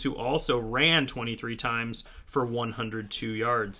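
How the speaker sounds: normal talking voice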